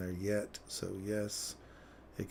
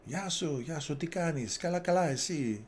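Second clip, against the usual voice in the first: high, loud playful Greek greeting; mock Greek greeting; 7 semitones higher, 4 dB louder than usual. Greeting his Greek friends, playful high call.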